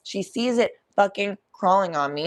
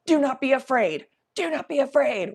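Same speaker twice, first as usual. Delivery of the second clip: brave pep talk; 6 semitones higher, 3 dB louder than usual. Retold or imitated. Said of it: "Me all the time when I have to be brave".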